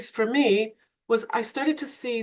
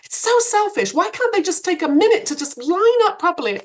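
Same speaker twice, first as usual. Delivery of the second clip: much higher, louder mock outrage at a bad parker; indignant inner thought; 9 semitones higher, 6 dB louder than usual. Recalling her own thought about a bad parker, high mock indignation. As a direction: play up your own self-righteous huff. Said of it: "I remember thinking".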